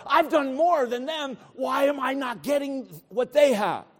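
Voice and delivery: high, loud protest; mock aggrieved complaint. Preacher voices our murmuring against God, mock aggrieved. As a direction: gripe like someone who feels cheated. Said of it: "we're saying".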